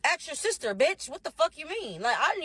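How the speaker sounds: high-pitched indignant comeback; acted outrage, pitched well up; indignant retort. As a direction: retell snapping back in disbelief. Acted retort to accusing sister.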